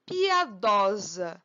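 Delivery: Piadosa said slowly, pia- with an open a; slow, deliberate Piadosa; archaic pronunciation. Shown as a demonstration. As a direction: drawl out Piadosa, stressing dó. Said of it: "o jeito arcaico de falar piedosa".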